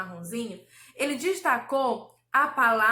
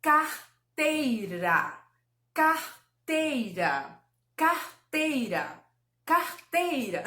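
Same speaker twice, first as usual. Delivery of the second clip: "Carteira" slowly in clear syllables, gradually tightening up; careful correct pronunciation; much slower than usual. Shown as a demonstration. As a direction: repeat "Carteira" slowly, each part distinct. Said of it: "tenho que falar ela corretinha". ("Carteira" corretinha, devagar e bem separada)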